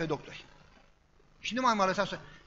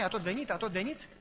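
ordinary talk, nothing marked

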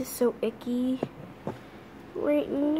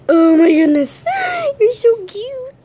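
very loud, very high gushing with a gasp; gushing squeal; 11 semitones higher, 11 dB louder than usual. Real, not acted. Owner squealing over her cute Maltese, very loud. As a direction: burst with delight at the puppy.

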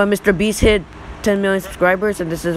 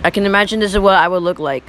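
normal talking voice

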